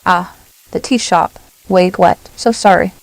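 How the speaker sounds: usual speaking voice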